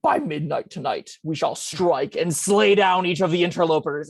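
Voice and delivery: slow, portentous delivery of a secret war message; ominous squirrel messenger. Acted. Tiny squirrel delivers a war message to the party's druid, slow and ominous. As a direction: intone it slowly, like a solemn plotter. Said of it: "looks up all adorably and goes".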